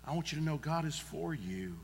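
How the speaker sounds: usual speaking voice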